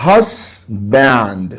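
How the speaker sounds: "Husband" in two drawn-out syllables, weight on HUS; teacher sounding out "Husband" slowly, stressing HUS; syllable stress demo. Shown as a demonstration. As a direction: stretch "Husband" apart, HUS first and stronger. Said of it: "capital letters में आपको words लिख के दिए हुए हैं, these all are actually what? Stress words".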